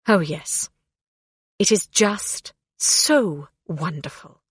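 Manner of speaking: drip contempt on wonderful; sarcastic. A narrator's reading. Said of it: "Maleficent's lip curled".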